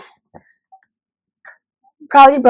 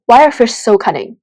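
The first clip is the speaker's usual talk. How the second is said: ordinary talk, nothing marked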